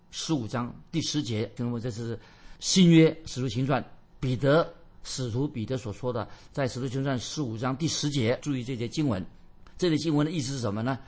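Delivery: usual speaking voice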